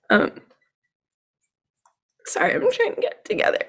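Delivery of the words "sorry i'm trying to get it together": fast, higher, breaking whisper; fighting tears to get the words out; holding back tears. Real, not acted. Guest apologizes while choking back real tears. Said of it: "trying to say this without crying".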